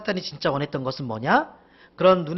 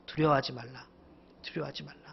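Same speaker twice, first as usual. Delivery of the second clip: much softer and lower; gentle, soft words; 4 semitones lower, 10 dB quieter than usual. Acted. The Lord as if kindly reassuring, gentle and soft. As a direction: say it low and mildly. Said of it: "좋은 말 부드럽게 하시는".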